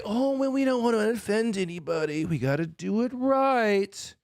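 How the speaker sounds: lightly voiced mock of a timid official; a hint of sneering at cautious bureaucrats, slowing down; mocking a politician. Imitating government officials worried about offending anyone, mild mockery. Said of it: "pussies in the government that say".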